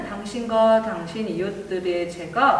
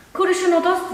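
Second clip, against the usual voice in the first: calling out; 8 semitones higher, 5 dB louder, faster than usual. Acted. Calling out to [name].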